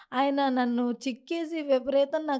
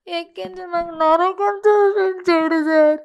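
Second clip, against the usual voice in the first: pushing out the words through anguish; real anguish, louder and higher, slow; distraught; 4 semitones higher, 7 dB louder, slower than usual. Real, not acted. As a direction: voice rising and breaking with real distress. Recounting ordeal, voice breaks.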